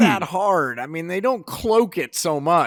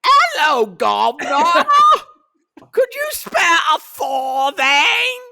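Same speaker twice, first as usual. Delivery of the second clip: loud, high Cockney street urchin; hammed-up Cockney beggar calling out; Cockney accent; 13 semitones higher, 7 dB louder, much slower than usual. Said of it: "to give you my Cockney accent".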